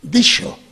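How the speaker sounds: dicho with sh in the middle, like dísho; medieval sh sound. Shown as a demonstration. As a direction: dicho con sonido sh medieval. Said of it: "se escribía con X".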